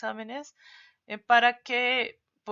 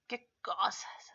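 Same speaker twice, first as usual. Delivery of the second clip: "¿Qué cosas?" whispered slowly, falling at the end; 4 dB quieter, slower than usual. Shown as a demonstration. Teacher whispers the example to the class. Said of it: "Así".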